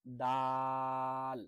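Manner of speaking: দাল drawn out very long, plain sound; long held letter. Shown as a demonstration. Teacher drawing out the letter for students. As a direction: hold দাল long and steady. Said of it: "একেবারে স্বাভাবিক".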